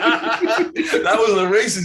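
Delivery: slow, high, laughing punchline. Real, not acted. Revealing father's real reason to Sasha, laughing. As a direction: stretch it out through laughter.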